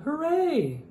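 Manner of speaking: high cheer; cheering hooray. Acted. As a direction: cheer the little truck.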